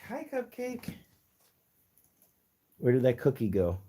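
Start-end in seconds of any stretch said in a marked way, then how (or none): 0.1-0.9 tiny, very high voice, softly; soft high-pitched cooing; pet greeting. Real, not acted.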